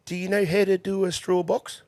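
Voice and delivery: put-on light Kiwi old-timer asking; old Kiwi stable hand quizzing the young kid, light Kiwi accent. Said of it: "We're gonna do an accent now".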